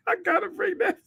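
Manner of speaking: high cry-laughing voice; wheezing through laughter. Real, not acted. Laughing so hard he cries.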